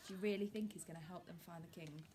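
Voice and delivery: usual speaking voice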